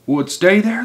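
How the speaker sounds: high, loud anxious question; crying out. A narrator's reading. Helpers doubt the glued table holds, crying out. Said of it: "they cried".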